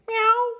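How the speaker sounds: high, bright kitten meow; playful kitten meow. Acted. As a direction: mew to ask for play. Kitten wants to play.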